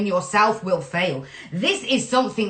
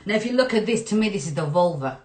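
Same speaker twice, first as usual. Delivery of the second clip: ordinary delivery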